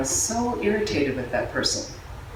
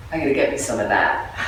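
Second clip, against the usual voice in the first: joking craving; 11 semitones lower than usual. Retold or imitated. Telling a friend about a new phone, jokingly low and greedy. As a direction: lower jokey greedy voice.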